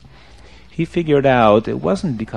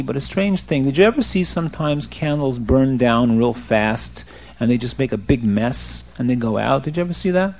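ordinary delivery, nothing marked

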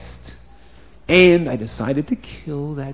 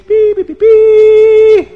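high, loud "beep" trumpet call; mimicked trumpet fanfare; 9 dB louder, much slower than usual. A narrator's reading. Storyteller sounds the trumpets for the king's bird, high loud tooting. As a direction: toot out a royal fanfare, last note held long. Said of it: "And they blew the trumpets".

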